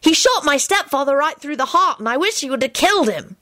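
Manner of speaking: put-on high, eager child voice; excited boy. Timothy tells Alex about his stepfather, animated.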